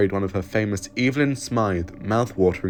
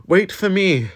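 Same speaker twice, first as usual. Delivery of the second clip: higher, louder call after someone leaving; 11 semitones higher, 8 dB louder, slower than usual. Acted. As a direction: shout to catch up. Calling after the Doctor.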